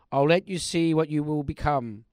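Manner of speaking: usual speaking voice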